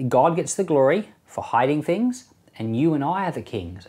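normal talking voice

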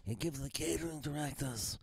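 a hint of a soft mob-boss rasp; lightly voiced Godfather advice; mock Godfather voice. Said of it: "he was talking like that".

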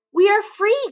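high, excited cry; joyful cry. Acted. Little monkeys just freed from their cage, joyful cry. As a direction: burst out in glee. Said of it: "cried the two little ones".

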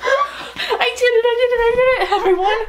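gleeful victory cry while laughing; triumphant laughing shout. Real, not acted. Vlogger crowing to viewers that she exploited Shayna, triumphant laughing shout.

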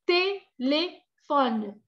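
TELÉFONO drawn out in separate syllables, French-style without the final O. Shown as a demonstration. Teacher showing students both accented E's, syllable by syllable. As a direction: say "teléfono" very slowly as French té-lé-phone. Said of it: "tienen las dos E acento agudo".

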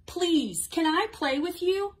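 high, strong, polite request; loud voice. A narrator's reading. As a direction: speak up and ask clearly. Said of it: "he said to Piggy in a loud voice".

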